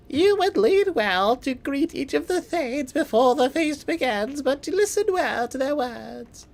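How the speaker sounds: high, slow put-on mother voice with a fake accent; mother's voice with an accent. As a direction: lean in and advise your son like an old Norse mother. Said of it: "as if I could do accents".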